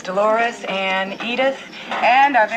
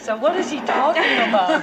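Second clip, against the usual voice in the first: quoting Molly; 7 semitones higher than usual. Retold or imitated. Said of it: "And Molly says".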